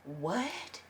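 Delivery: very soft, puzzled question; baffled hush. Retold or imitated. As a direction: barely breathe a confused question. Retold baffled reaction.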